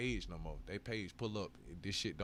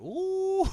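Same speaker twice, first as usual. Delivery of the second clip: shrieking high laugh, much louder; squealing laugh; 16 dB louder than usual. Real, not acted. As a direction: let out a long squealing cackle. Explodes laughing.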